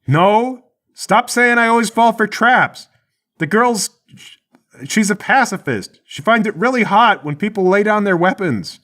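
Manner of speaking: high-pitched, protesting holler; defensive yell. Acted. Charlie lying to Mike down the hall, defensive high call. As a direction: call out an indignant denial, then a halting excuse.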